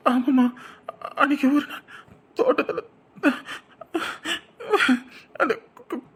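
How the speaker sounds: high, halting, breaking into a cry; tearful confession. Acted. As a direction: stammer out the guilty admission. Son tearfully confesses to parent.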